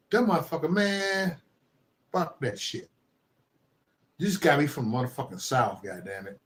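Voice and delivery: ordinary delivery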